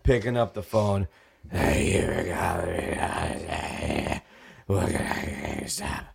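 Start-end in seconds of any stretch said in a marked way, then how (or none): 1.5-6.1 barely-there bronchitis croak, slow; croaky sick voice. Retold or imitated. Sick manager croaking into the store phone, hoarse and slow. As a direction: rasp it out like you can hardly talk. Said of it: "I'm barely able to talk".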